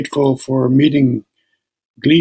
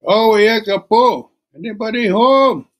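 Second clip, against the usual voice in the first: calling out again; 8 semitones higher than usual. Acted. Young woman calling out a second time. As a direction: stretch the call out toward the house. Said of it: "she called out again".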